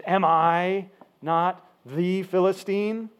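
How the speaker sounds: slow, weighty emphatic challenge. Retold or imitated. Goliath challenging the Israelites, emphatic. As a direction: spread the words out with heavy emphasis. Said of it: "And then he says emphatically".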